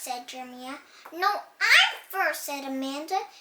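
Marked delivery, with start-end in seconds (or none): 1.1-2.4 loud, slow insistence; bossy shout. Acted. Amanda insisting she goes first, loud shout. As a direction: shout back to win the turn. Kids fighting over paint.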